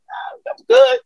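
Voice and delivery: very high, nervous no thanks; squeaky refusal. Retold or imitated. He turns down riding with the drunk dad in a squeaky high voice. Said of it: "and I was like".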